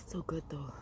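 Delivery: breathy whisper after a sip. Real, not acted. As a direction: sigh it out in a whisper.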